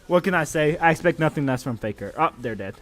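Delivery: normal talking voice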